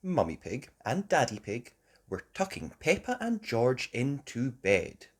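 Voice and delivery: usual speaking voice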